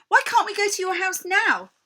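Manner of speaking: put-on high, loud eager boy; eager plea. Greedy Edmund begs the queen, high and loud. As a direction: beg for it now.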